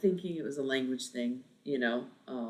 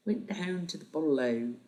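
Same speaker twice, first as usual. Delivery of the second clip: attempted Australian accent; 2 semitones higher than usual. Shown as a demonstration. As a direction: have another go at Australian slang vowels. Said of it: "in an Australian accent".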